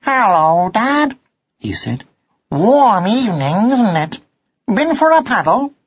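high, lilting son's voice; cheery young cat. A narrator's reading. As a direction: greet dad in a high, sing-song voice. Son cat greets his father.

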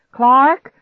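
high, loud, rising call. A narrator's reading. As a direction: call through the house for him. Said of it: "I called out again".

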